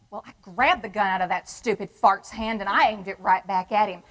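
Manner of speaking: usual speaking voice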